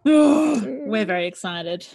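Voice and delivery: usual speaking voice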